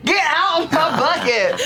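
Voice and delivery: high, laughing yell; laughing shout. Acted. Yelling at Toby in bucket.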